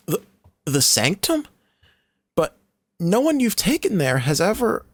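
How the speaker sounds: put-on high, scared young girl; frightened girl. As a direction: gasp it out in fear.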